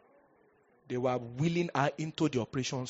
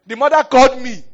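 very high, very loud proclamation; loud preaching climax; 11 semitones higher, 17 dB louder than usual. Real, not acted. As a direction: hit the climax of the testimony hard. Climax of the story.

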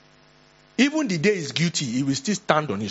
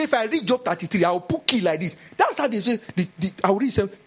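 higher, boasting of a Bible spell; mock witch boast; 6 semitones higher than usual. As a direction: threaten like a village witch. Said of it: "you are sounding like, like a witch".

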